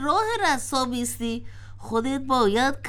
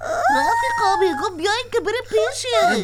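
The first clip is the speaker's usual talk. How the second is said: calling out; 6 semitones higher, 4 dB louder than usual. Acted. Calling friends to come.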